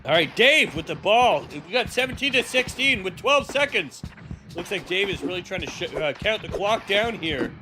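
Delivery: hyped live play-by-play call; sports announcer. Acted. Calling the final plays.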